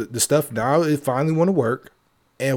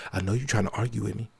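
low and quick; 3 semitones lower, 9 dB quieter, faster than usual. Shown as a demonstration. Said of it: "That's why I said it like that".

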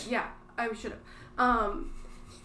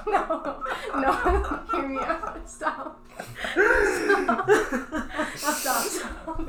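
high, helpless laughter between words; laughing plea; 5 semitones higher, 6 dB louder, much slower than usual. Real, not acted. Storyteller begging friends to listen, laughing helplessly. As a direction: beg them to stop while cracking up. Pleads through laughter to continue.

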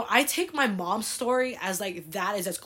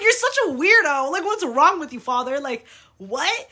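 much higher, louder teasing incredulity; laughing disbelief; 7 semitones higher, 9 dB louder than usual. Retold or imitated. Daughter teasing her father about borrowing money, incredulous. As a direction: call it out in high, amused disbelief.

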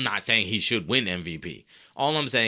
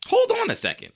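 high, loud double take; loud, high-pitched dawning disbelief; sudden realization; 5 dB louder than usual. Retold or imitated. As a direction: stop everything, pitch leaping up. Repeats the thought, far stronger.

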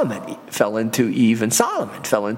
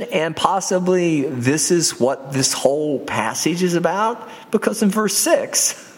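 usual speaking voice

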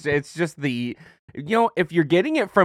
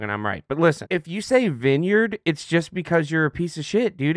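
ordinary delivery

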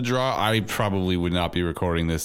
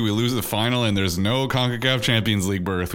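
ordinary talk

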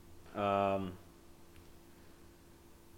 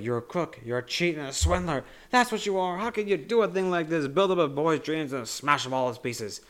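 a higher, louder mock tirade; Grandpa Joe's outrage; 7 semitones higher, 4 dB louder than usual. Retelling Grandpa Joe's anger at Wonka, higher mock outrage. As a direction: hurl the insults as an outraged grandpa.

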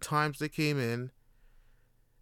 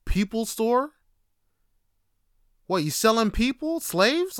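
incredulous mockery; 8 semitones higher, 5 dB louder than usual. Real, not acted. Mocking the agency name.

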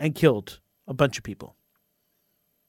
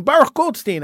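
mock scream; 6 semitones higher than usual. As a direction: shout the name like a hostile crowd, half-acted. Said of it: "Arabs will still scream".

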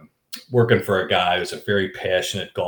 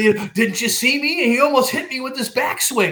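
very high comic indignant voice; brazen insistence. Retold or imitated. Friend claims the backswing nearly hit him, indignant. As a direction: squeal it as mock-offended insistence, through laughter.

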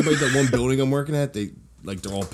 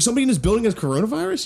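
alarmed disbelief; 11 semitones higher, faster than usual. Retold or imitated. Shocked question retold.

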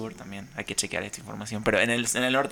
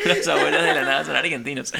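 laughing; 3 semitones higher, 7 dB louder than usual. Real, not acted. Laughing over band name joke.

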